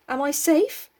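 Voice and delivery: quavering. A narrator's reading. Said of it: "quavered Piggy".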